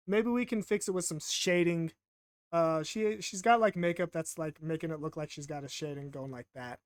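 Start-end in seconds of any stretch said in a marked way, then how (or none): none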